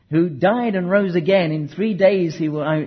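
ordinary talk, nothing marked

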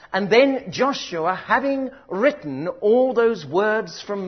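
loud, slow, drawn-out preaching; booming, deliberate sermon delivery; full-force preaching. Real, not acted. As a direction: proclaim every word to the back row.

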